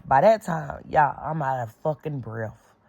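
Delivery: ordinary talk, nothing marked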